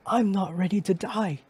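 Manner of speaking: rising into a high, loud plea; begging cry that breaks high; desperate shout. Acted. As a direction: scream the please at the end. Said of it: "I shouted that".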